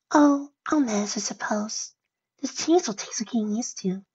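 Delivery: high, small voice fading to a whisper; timid, dejected high voice; meek, resigned pony. Acted. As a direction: sigh it out high and softly. Fluttershy sighs over permanent form.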